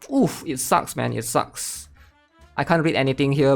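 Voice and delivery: ordinary talk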